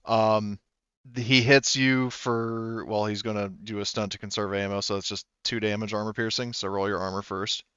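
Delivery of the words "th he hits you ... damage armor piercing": ordinary delivery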